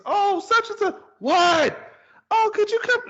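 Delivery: very high, loud mimicked exchange; imitated kids and parent. Parent calls and kid yells back, high mock shouting. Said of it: "here now you hear kids".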